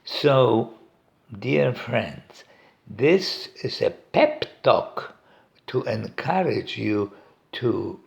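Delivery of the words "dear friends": ordinary talk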